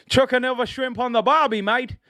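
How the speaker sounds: high, hammed-up Aussie twang; mock Australian accent. To the Australian chat, a mock Australian accent. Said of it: "the accent".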